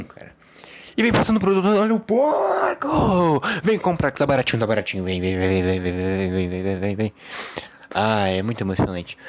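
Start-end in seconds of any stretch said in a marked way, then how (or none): 1.0-3.4 market announcer. Acted. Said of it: "é o narrador de mercado". 3.6-7.1 rapid repeated calling out to shoppers; hawker calling. Acted. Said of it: "é o narrador de mercado".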